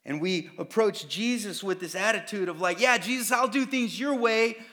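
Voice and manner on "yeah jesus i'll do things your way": mock eager agreement.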